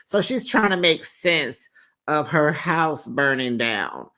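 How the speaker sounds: ordinary talk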